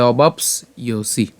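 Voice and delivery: "অবাপস ইয়সি" slowly as o-baaps iyo-si; y-glide pronunciation. Shown as a demonstration. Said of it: "ইয়র মতো হবে". ("অবাপস ইয়সি" ধীরে, জফলা ইয়-র মতো)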